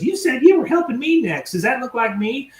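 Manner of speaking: high-pitched mock indignation; getting mad. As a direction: imitate a customer losing patience. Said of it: "is starting to get mad".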